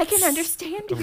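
high-pitched mock relief; playfully overwrought delight; retold excitement. Retells relief at hearing familiar accent.

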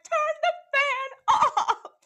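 hammed-up fed-up yell from bed; retelling a middle-of-the-night yell, hammed up; mock yell. Waking him up, fed up.

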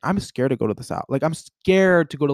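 normal talking voice

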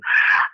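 short jittery laugh; nervous laugh. Real, not acted. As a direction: laugh off the nerves. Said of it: "I'll do the nervous laugh together".

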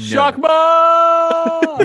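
high, loud joyful yell; excited shout. Real, not acted. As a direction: shout the title with glee. Excited shout of movie title.

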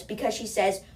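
normal talking voice

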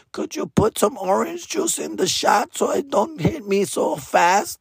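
high, meek asking voice; shy guy begging for orange juice in his shot; timid polite request. Retold or imitated. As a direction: ask the bartender sheepishly, voice up high. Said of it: "I was a guy with a".

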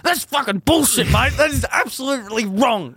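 imitated outraged rebuke, pitched high; mock-furious scolding, high and emphatic; angry telling-off. Mate to the kid caught stealing, high angry telling-off.

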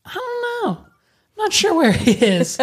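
high, squeaky feigned innocence; mock innocent squeak. Telling stepmom he has no idea where dad is, in a high mock-innocent squeak. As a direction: play dumb in a sudden high squeak. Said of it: "And I'm like".

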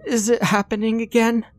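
put-on high, panicked boy's cry; almost screaming in fear; near-scream. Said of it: "Bran nearly screamed".

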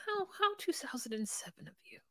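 mocking whisper. Snarky whispered aside about shoes.

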